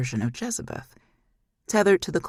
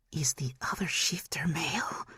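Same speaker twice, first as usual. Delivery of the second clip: sly whisper; 7 dB quieter than usual. Acted. Demon's lewd interest.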